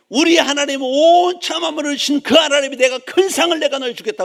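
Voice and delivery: very loud, high proclamation; impassioned pulpit shout, sustained high; full-force preaching. Real, not acted. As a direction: thunder the promise to the congregation. Preacher proclaims God's promise.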